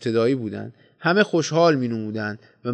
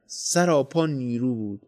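plain reading voice

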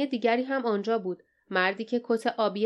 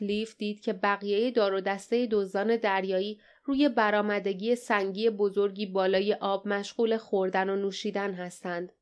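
ordinary delivery

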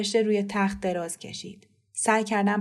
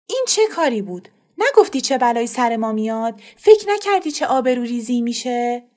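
louder, furious scolding of the daughter; scolding aunt; 3 semitones higher, 7 dB louder than usual. Acted. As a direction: tear into the runaway girl. (سرزنش خشمگین مادر به دختر)

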